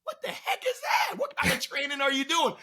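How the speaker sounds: wife's very high, shrieking "what the heck"; startled wife. Retold or imitated. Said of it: "My wife is like".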